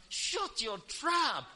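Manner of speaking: slow, stern put-down, mock-rebuking; stern slow rebuke; scolding. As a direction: snap it slowly like a scolding parent. Preacher rebukes the listener.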